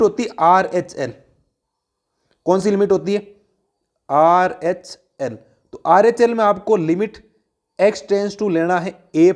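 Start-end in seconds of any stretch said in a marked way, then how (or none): 4.1-5.4 each letter drawn out, pauses between; spelled out slowly. Real, not acted. Teacher answers own question on which limit, spelled out slowly.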